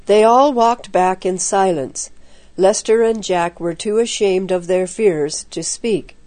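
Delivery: usual speaking voice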